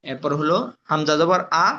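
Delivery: ordinary delivery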